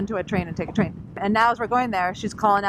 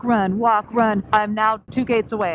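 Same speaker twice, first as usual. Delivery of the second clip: normal talking voice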